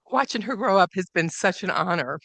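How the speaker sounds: usual speaking voice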